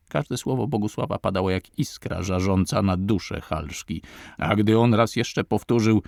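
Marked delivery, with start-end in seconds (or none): none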